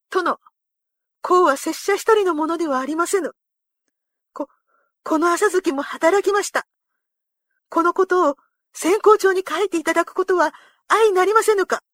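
high, forceful samurai entreaty; earnest plea. A narrator's reading. Retainer asking his lord to honour his horse, pleading earnestly.

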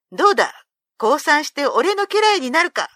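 loud and high, crowing victory; triumphant demand. A narrator's reading. Ushiwaka on top of Benkei demands surrender: loud triumphant crow. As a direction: press the beaten giant to yield.

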